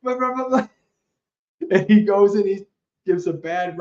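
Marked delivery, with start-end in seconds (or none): 0.0-0.7 much higher, indignant outburst; hammed-up outrage at the monks; mock furious husband ranting; angry husband. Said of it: "the husband got really angry and he's like".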